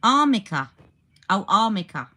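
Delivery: Armica said loud, with an M in the middle; realising it's Armica with an M; armica, stressing AR, M not N; corrected pronunciation. Shown as a demonstration. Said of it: "not arnica".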